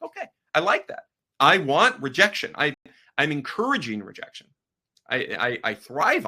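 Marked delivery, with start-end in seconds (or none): 0.0-1.0 much higher, chirpy "Okay, I like that"; a hammed-up happy reaction to being turned down; mock cheerful. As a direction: toss it off in a bright, high falsetto. Mimics own cheerful reaction.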